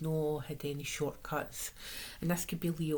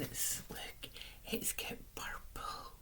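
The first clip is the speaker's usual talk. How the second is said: excited whisper; 7 semitones higher, 7 dB quieter, slower than usual. Real, not acted. Excited whisper over deck.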